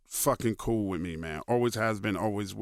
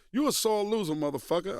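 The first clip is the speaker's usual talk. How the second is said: a higher, lightly voiced Jordan ribbing him; mock Jordan tease; 7 semitones higher than usual. Retelling Jordan teasing him, lightly voiced high tease. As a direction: tease him like a cocky star grabbing your neck.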